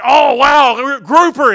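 high, loud burst of delight; imitating a thrilled exclamation; excited. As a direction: gush it out, thrilled by the news. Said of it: "She was excited about that".

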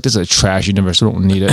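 low-pitched villain dismissal; Kang. Acted. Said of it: "introduced Kang and She-Hulk and be like".

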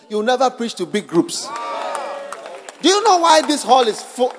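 loud, emphatic preacher's exhortation; preaching at full force. Acted. Preacher telling the congregation why the hall is full, loudly. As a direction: hammer each line at the hall.

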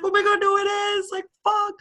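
very high-pitched retold panic; mock panicked backtracking. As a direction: frantically reassure an upset friend, hammed-up and high. Retold panicked backtracking to upset friend.

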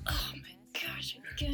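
weary, breathy under-the-breath groan; exasperated whisper. Retold or imitated. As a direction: sigh it out, fed up. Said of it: "he's just like".

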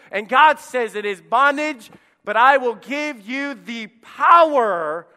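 high, loud preaching shout; full-force preaching. Acted. Preacher shouting the truth at his church, loud and high. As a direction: drive it home to the congregation.

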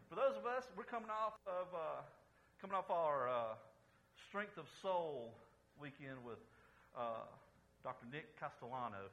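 normal talking voice

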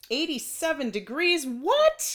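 high, loud disbelieving what; shocked what. Real, not acted. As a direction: gasp out a stunned what. Shocked at October heat.

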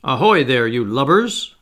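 loud pirate-captain hail, mimicking Hook; imitating Hook. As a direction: Peter faking the captain's bellow across the water. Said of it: "imitated the voice of Hook".

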